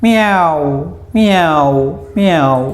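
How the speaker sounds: high, falling meows; drawn-out meows that glide downward; imitated cat meow. Shown as a demonstration. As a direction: mimic a cat mewing. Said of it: "ക്യാറ്റിൻ്റെ ശബ്ദം എങ്ങനെയാണ്?".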